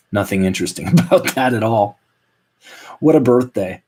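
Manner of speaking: ordinary talk, nothing marked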